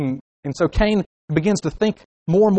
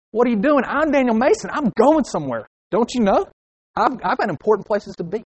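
high-pitched, self-important outrage; mock road-rage rant; indignant driver; 6 semitones higher than usual. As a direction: protest as if someone cut you off. Acted driver cut off in traffic.

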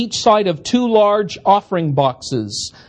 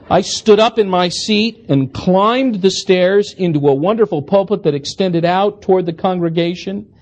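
normal talking voice

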